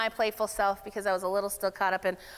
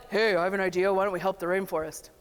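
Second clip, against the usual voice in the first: low mock male voice; Bob; 3 semitones lower than usual. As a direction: imitate a man pitching an idea, voice dropped. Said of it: "then Bob's like".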